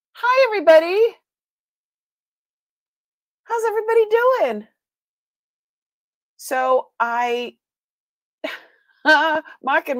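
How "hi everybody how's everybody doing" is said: bright, much higher, louder hello to the chat; excited greeting. Real, not acted. Streamer greeting her viewers, excited high and loud. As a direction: beam at the whole chat as you greet them.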